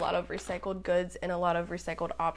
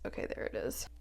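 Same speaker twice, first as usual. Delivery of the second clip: whisper; 10 semitones lower, 10 dB quieter than usual. Real, not acted. Whispered aside while handling tape.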